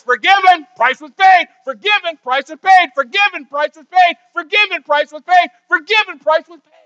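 triumphant shout. Pretend. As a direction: build it to a triumphant roar. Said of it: "He says".

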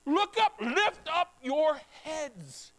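urgent call, then slow on lift up your heads; Jesus commanding. Acted. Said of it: "he says".

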